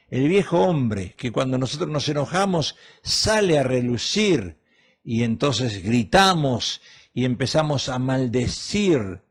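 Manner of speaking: ordinary talk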